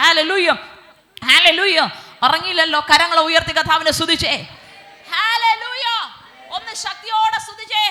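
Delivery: loud, high-pitched preacher's exhortation; calling out to stir up worship; rousing praise call. Real, not acted. Rousing congregation to praise forcefully.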